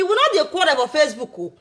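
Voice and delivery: usual speaking voice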